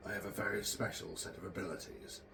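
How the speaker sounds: a hint of a gruff movie hero, lightly done; half-hearted, terrible Liam Neeson accent; Liam Neeson impression. Hobby vlogger tossing in a famous film line, deliberately bad impression. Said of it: "that was a terrible accent, a terrible impression".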